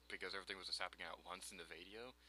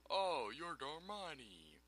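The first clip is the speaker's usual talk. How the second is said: slow amazement; 8 semitones higher, 4 dB louder, much slower than usual. Acted. Reading dialogue, slow amazed.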